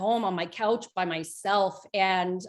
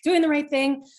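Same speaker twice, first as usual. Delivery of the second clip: higher, louder, anxious rush of a question; freaking out; 6 semitones higher, 6 dB louder than usual. Retold or imitated. Leaving full-time teaching, a higher, louder, panicky question. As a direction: ask it like the floor is dropping away. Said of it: "I'm freaking out".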